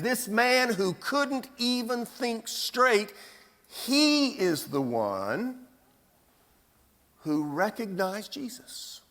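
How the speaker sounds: ordinary talk, nothing marked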